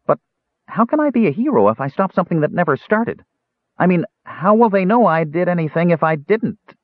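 high, puzzled kender voice; a young kender working through a riddle. A narrator's reading. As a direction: kender puzzles over his heroism, high-pitched.

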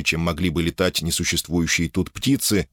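ordinary delivery

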